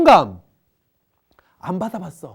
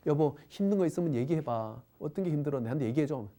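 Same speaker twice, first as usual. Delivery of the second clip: gentle coaxing wife; 6 semitones lower, 9 dB quieter than usual. Acted. Wife asks husband to talk.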